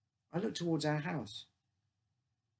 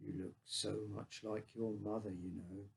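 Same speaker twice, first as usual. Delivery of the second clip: sighing; 6 semitones lower, 9 dB quieter than usual. A narrator's reading. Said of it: "sighed and said".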